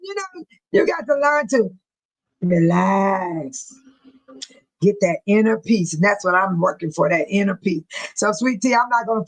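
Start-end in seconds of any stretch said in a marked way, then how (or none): 2.4-3.7 lower, drawn-out soothing "relax"; a hint of a zen guru; calm relax. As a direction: sink into a calm low voice. Advising calm, inner peace.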